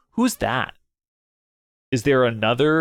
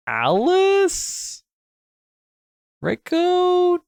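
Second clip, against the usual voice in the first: surprised; much slower than usual. Acted. Voicing startled character line.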